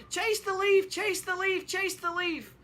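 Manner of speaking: very high, loud playful squeal; little girls shrieking happily while chasing a leaf; gleeful children. Retold or imitated. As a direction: chant it like kids at play. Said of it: "yelling and screaming ... laughing and giggling".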